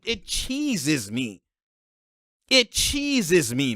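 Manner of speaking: slow, drawn-out annoyance; really fed up, dragging it out; exasperated. Real, not acted. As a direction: stretch out every word in frustration. Venting annoyance at the play.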